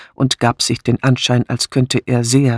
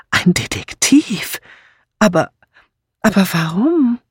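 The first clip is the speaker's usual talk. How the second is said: astonished; 7 semitones higher than usual. A narrator's reading. Mrs. Easterbrook hearing of a detective, astonished. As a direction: stammer it out in raised-pitch amazement. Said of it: "ließ vor Erstaunen ihre Tasche fallen".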